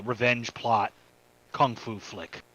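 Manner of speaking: ordinary talk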